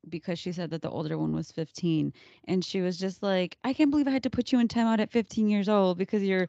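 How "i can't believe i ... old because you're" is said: loud acted telling-off; lightly voiced exasperated mother; scolding mom. Voices mom scolding her teenager.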